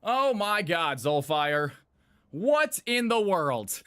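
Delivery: ordinary talk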